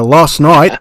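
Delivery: stretched-out mock-Aussie vowels; slow, exaggerated Australian accent; Australian accent attempt. Host explains guest's time zone, in an attempted Australian accent. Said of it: "I hope I'm pronouncing this right".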